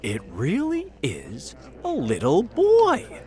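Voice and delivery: high, slow, swooping disbelief; drawn-out, high-pitched astonishment; amazed cry. A narrator's reading. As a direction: marvel aloud at the sight. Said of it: "cried the firefighter".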